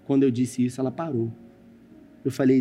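usual speaking voice

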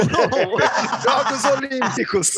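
slow answer through laughter; laughing. Real, not acted. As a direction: laugh while correcting yourself. Contestant laughs correcting answer.

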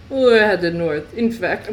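put-on slow, slipping French accent. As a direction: attempt a French accent and half lose it. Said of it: "I lost my French accent".

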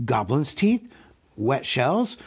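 ordinary delivery

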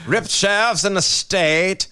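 slow, grand royal proclamation; town crier. Pretend. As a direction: announce it like a herald. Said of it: "the, the Lord, His Majesty".

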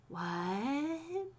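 soft, rising, puzzled question; hushed whisper. A narrator's reading. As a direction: ask it under your breath. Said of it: "Mark whispered".

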